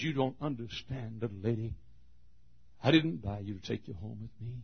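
soft, tender reassurance; gentle, kind. Acted. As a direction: soothe her gently. Kind man speaks gently.

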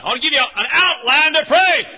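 high, loud proclamation; the Lord speaking. Acted. Preacher voicing the Lord, loud and high. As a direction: announce it like God. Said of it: "Lord says".

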